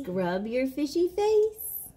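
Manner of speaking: put-on slow, lilting read-aloud for a toddler; bedtime story reading. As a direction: read it like lulling a child to sleep. Reads bedtime book aloud.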